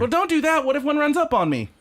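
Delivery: frightened yelp about the roach; real alarm. Real, not acted. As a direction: squeal it, scared a bug will come. Scared of a roach.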